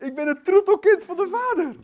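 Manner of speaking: very high, loud exultation; jubilant shout. Real, not acted. Preacher declaring himself the Father's darling child, jubilant shout. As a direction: shout it with overflowing delight.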